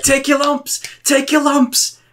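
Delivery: very high-pitched repeated jeer; mock taunt. Players beating up a guy in game, mock taunt. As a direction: jeer it high, imitating the attackers.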